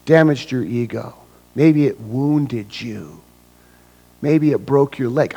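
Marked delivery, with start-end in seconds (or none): none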